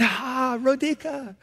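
high, soft mock-delighted call; delighted greeting. Preacher spotting Rodica on a night walk, high soft greeting. Acted greeting on meeting her again.

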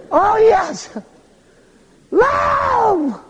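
high, drawn-out swooning cry; mock swoon. Preacher mocking young love, high swooning cry. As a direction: moon over the word like a lovesick teen.

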